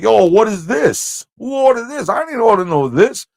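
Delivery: put-on "Yo, what is this?" in a high, brash New York accent. Confronting the waitress, brash New York accent. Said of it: "I get it all New York".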